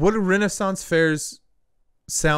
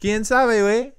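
higher, playful Mexican Spanish; mock Mexican accent; 7 semitones higher, 4 dB louder than usual. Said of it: "you mock Mexicans".

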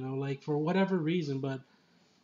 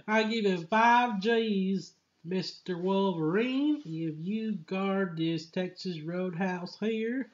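higher, hammed-up country-boss voice; 4 semitones higher, slower than usual. Farmer offering the clone a job, hammed-up voice. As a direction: play the sleazy farmer offering cash. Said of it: "It's like".

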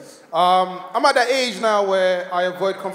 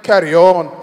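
loud put-on Scottish command; imitated Scottish audience member's loud order; Scottish accent; 3 semitones lower, 11 dB louder, slower than usual. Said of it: "he looked at me and went".

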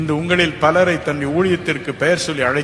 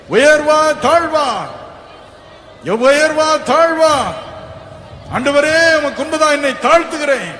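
forceful preaching; 11 semitones higher, 5 dB louder than usual. Acted. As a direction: press the crowd to choose. (உரத்த பிரசங்கம்)

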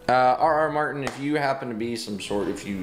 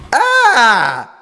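loud, high "ah" scream; frustrated scream; 10 semitones higher, 12 dB louder than usual. Real, not acted. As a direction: scream out at losing. Dies in the game.

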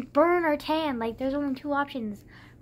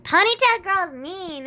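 put-on whiny kid; 6 semitones higher, 7 dB louder, slower than usual. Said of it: "And he was just kind of like".

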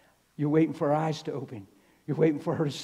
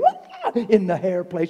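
laughing; 3 semitones higher, 7 dB louder, slower than usual. Real, not acted. Preacher laughing at his own story.

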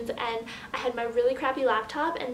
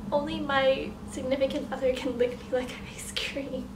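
ordinary delivery

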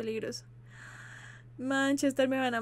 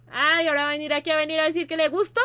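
loud, higher, giddy exclamation; excited outburst; 3 semitones higher, 10 dB louder, faster than usual. Real, not acted. Reacting to the story's romance with a loud excited cry. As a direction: blurt it out in excited disbelief.

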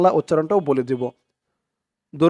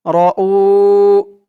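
usual speaking voice

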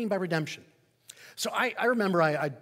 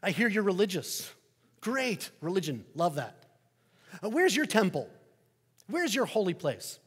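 higher, louder, chatty skeptical neighbor; Roman neighbor; 4 semitones higher, 5 dB louder than usual. Acted. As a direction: play the curious pagan neighbor, bright and pushy. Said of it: "the neighbor says".

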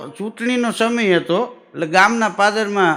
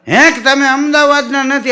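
"હે" shot up high and louder, then "તમે અમદાવાદના નથી?" as a stunned question; shocked disbelief; 8 semitones higher, 4 dB louder than usual. Acted. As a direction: gasp out "હે" in disbelief, pitch leaping up. Pilgrim shocked by god's claim. ("હે" ઊંચા અવાજે, આશ્ચર્યથી પૂછવું)

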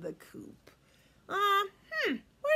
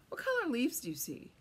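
usual speaking voice

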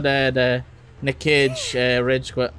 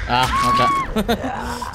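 surprised yelp; much slower than usual. Real, not acted. Sudden surprise in chase.

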